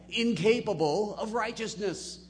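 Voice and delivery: ordinary talk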